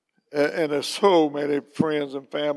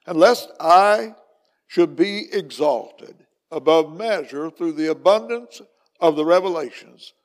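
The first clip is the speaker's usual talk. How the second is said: scripture reading; 9 dB louder than usual. Real, not acted. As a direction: proclaim the verse with measured force. Reads the Bible verse aloud.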